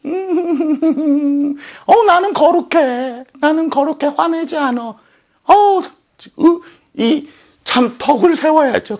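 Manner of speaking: high, sing-song fake holiness; mock pious voice. Preacher mocking believers who call silence holy, in a mock pious voice. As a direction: parody a smug saint who never gets angry.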